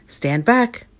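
warning cry. A narrator's reading. The doctor clearing companions from the freezer door, calling out. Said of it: "cried the doctor".

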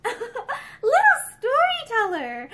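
high, slow laughing exclamation. A narrator's reading. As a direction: tease her through a laugh. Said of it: "Joyce laughed".

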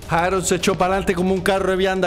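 casual put-on Cuban accent. Player accusing Harold in a light Cuban accent. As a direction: accuse Harold like a Havana local.

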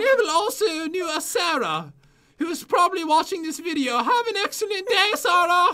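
high mock British voice, climbing at the end; British accent. Player greeting a viewer named Sarah, mock British accent. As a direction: finish the tale in a hammy British accent. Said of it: "read this in a British accent".

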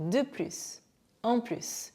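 "plus" with its final S sounded in both phrases; S pronounced. Shown as a demonstration. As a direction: say "plus" as "plüss". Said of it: "이미 S 발음하고 계셨죠".

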